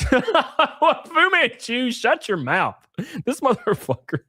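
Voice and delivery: drawn out through a laugh, high; laughing name. Real, not acted. Streamer cracking up at a donor's cheeky message.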